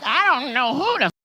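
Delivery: hammed-up voicing of her plan; imitating her. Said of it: "And she's like".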